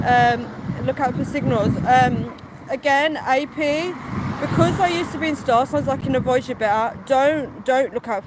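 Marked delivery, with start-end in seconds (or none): none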